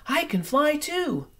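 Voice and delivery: put-on high, small baby bat voice; little bat's thought. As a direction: think it hopefully as a little bat. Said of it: "thought Stella Luna".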